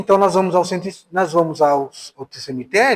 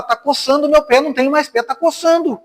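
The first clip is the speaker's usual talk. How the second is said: put-on higher, plaintive old mother's complaint; mother's voice, higher and complaining; imitating elderly mother; 7 semitones higher than usual. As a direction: mimic mom fussing about her foot. Mother quoted complaining of phantom itch.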